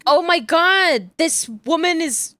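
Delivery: high, drawn-out retold amazement; excited fan gushing. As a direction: re-enact being thrilled by a new idol. Retelling delighted reaction to the video.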